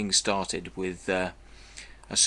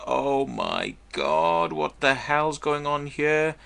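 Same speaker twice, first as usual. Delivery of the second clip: retold shock; 6 semitones higher than usual. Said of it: "and I thought".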